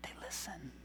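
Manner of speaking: whispered in disbelief; amazed whisper to himself. Retold or imitated. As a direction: breathe it out like a secret. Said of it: "I'm like".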